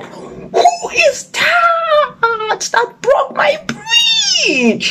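high, drawn-out roar of outrage; slow, very high-pitched indignant cry; angry crocodile. A narrator's reading. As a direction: wail it furiously, stretching the last word. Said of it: "made the crocodile very angry".

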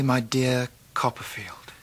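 slow, soft, dread-filled whisper. Acted. Reading a bad-news letter from a friend, slow whisper. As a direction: read the words under your breath, slowly.

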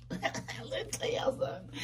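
giggly hushed whisper. Real, not acted. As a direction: whisper it like a secret. Leaning in to share gossip.

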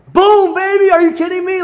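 triumphant shout. Retold or imitated. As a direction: yell it like you just won. Celebrating the surprise full credit.

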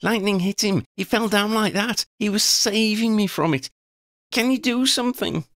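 high, distressed gasping plea; frantic high voice pleading for help; gasped. A narrator's reading. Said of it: "Freddie gasped".

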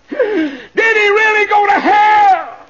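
high, slow, very loud wail; drawn-out shout of disbelief; anguished widow's cry. Acted. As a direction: wail the question in horror, stretching it out.

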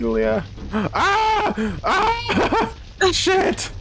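high, playful panicked screams; mock panic scream. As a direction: shriek in mock terror. Falling into the engine.

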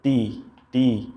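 "তি" drawn out very slowly; slow drill. Shown as a demonstration. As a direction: stretch out "তি" for the class. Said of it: "তাজের".

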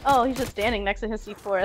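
ordinary talk

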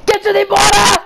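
hammed-up panicked movie-hero shout; Lewis. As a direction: shout a comic order to flee. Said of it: "this is gonna be Lewis".

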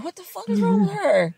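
high-pitched incredulous reaction; imitating appalled bystanders; mock disbelief. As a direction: act out a baffled onlooker, voice shooting up. Acting out people's baffled reaction.

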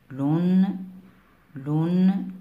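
u vowel in "لن", then ring the n; damma tanween. Shown as a demonstration. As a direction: say "لن" as a rising "lunn". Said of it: "اللام منون بالضم".

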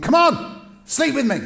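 the wife grabbing his cloak and pushing hard; demanding. Acted.

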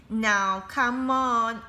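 a headmaster straining for authority; a hint of a stern headmaster; authoritative headmaster. A narrator's reading. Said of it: "trying his hardest to sound authoritative".

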